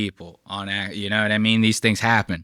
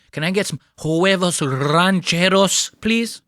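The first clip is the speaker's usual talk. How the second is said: higher, exaggerated Spanish accent; put-on Spanish accent, pitched up; 4 semitones higher than usual. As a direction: lay on the Spanish accent, rolling the r.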